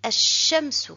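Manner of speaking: Ash-shamsu read out in Arabic with the L silent. Shown as a demonstration. Teacher reads the Arabic word for pupils. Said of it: "je lis directement".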